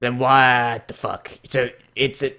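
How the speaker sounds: usual speaking voice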